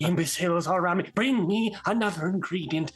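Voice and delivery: lightly voiced fast ranting villain; ranting goblin wizard. Goblin wizard ranting at his minions. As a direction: rant at the fools.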